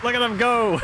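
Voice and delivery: loud, high, excited cheer; delighted exclamation. Real, not acted. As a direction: cry out in delight at the spectacle. Delighted watching silly units charge.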